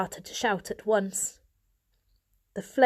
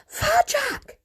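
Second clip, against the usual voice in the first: sharp high hiss of his name; 4 dB louder than usual. A narrator's reading. Mother hisses at her son, sharp and high. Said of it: "hissed Mother".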